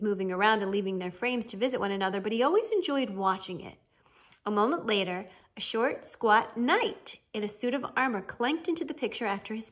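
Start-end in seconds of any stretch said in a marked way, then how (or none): none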